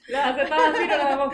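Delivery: loud laughter through words; laughing. Real, not acted. Laughing at queen joke.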